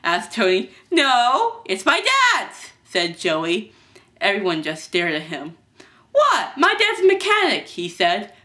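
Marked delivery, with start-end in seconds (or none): 0.9-2.7 high-pitched, loud defensive retort; protest, stretching the last word; indignant denial. Acted. Boy defends himself. 6.1-7.7 high-pitched indignant protest; defensive. Acted. Boy defends himself to staring crowd.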